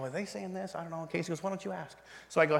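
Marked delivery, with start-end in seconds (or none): 0.0-1.4 very soft, furtive question; hushed aside. Retold or imitated. Husband quietly asks wife during the group, hushed aside. 1.4-2.0 soft, retold aside; hushed reply. Wife nudges husband during the group, hushed reply.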